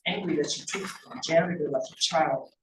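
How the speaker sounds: ordinary delivery, nothing marked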